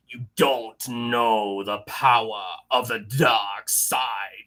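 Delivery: slow, low, strained dark lord voice; Emperor voice. Retold or imitated. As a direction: push it down into a gravelly Sith growl. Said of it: "I do not do a good Emperor voice".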